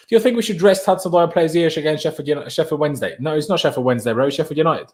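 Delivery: usual speaking voice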